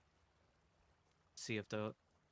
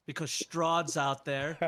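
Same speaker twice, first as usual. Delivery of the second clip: louder and slower, pointed; a little louder; 4 semitones higher, 9 dB louder, much slower than usual. Acted. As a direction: raise the voice so everyone hears the warning. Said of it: "I say a little bit louder".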